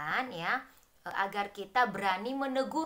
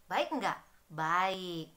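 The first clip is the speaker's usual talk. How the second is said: usual speaking voice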